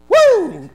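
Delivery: loud, high whoop of pride; a hollered cheer for Texas; high cheering woo. Acted.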